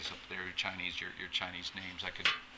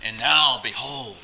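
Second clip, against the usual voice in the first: raised, declaiming pronouncement; forceful rebuke; 4 semitones higher, 9 dB louder, slower than usual. Acted. Paul pronouncing judgment on Elymas, forceful rebuke. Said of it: "He said it with some force".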